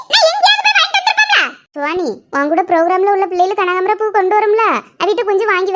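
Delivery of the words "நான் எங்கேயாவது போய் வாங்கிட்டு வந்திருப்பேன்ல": high-pitched exasperated scolding; angry scolding. Acted. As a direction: scold the child, pitched right up. Mother scolds the child.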